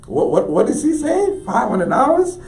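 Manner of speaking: high-pitched stunned repeating; incredulous. Retold or imitated. Artist reacting to senator's offer, high and incredulous. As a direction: squeal the question in disbelief.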